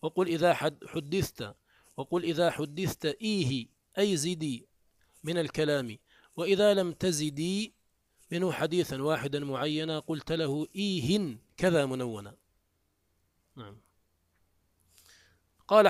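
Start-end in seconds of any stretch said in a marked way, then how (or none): none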